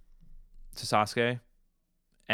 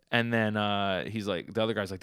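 ordinary talk, nothing marked

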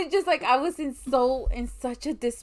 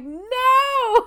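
retold sob; 9 dB louder than usual. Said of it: "I'm like".

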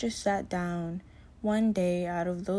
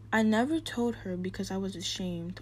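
ordinary talk

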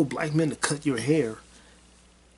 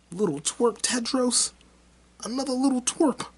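mocking high squeal; 13 semitones higher than usual. Real, not acted. Vlogger mocking the Tedros character, much higher. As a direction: sneer it in a high squeaky taunt.

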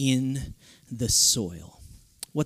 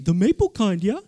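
slightly higher, louder dog voice; eager talking dog; 5 semitones higher, 5 dB louder than usual. Retold or imitated. Dog interrupting owner about bacon, lightly voiced eagerness. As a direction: butt in as an excited dog.

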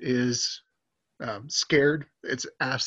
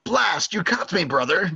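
mustache twirling villain; 7 semitones higher, 4 dB louder than usual. Acted. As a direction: caught villain, higher and hammy. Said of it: "twirls his mustache".

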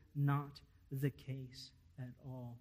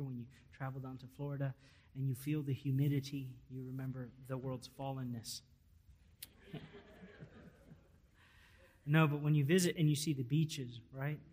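ordinary talk

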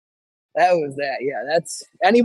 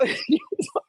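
high burst of laughter; 7 dB quieter than usual. Real, not acted. Laughs at his own story.

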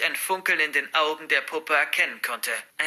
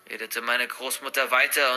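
plain reading voice

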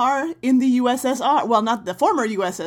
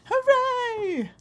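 excited cheer. Acted. Cheering the show's start.

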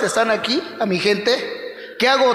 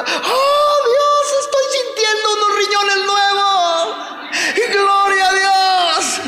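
woman crying out; 9 semitones higher, slower than usual. Retold or imitated. Pastor voicing a healed woman shouting praise, high ecstatic cry. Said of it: "allá gritó una señora".

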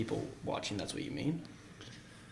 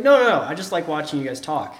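mock protest; 5 semitones higher, 9 dB louder than usual. Acted protest of a caught watcher.

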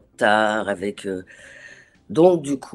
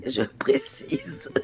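soft, drawn-out whisper with a laugh; whispered aside; 13 semitones higher, 6 dB quieter, much slower than usual. Real, not acted. Guest clarifies her poor English to the hosts, whispered aside. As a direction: slip it in under your breath, slowly.

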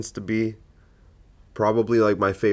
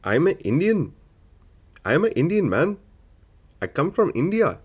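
put-on higher, sing-song Indian accent; hammed-up Indian accent, pitched up; bad Indian accent; 7 semitones higher than usual. Comedian doing a deliberately bad Indian accent for laughs. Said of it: "I'm not doing a good Indian today".

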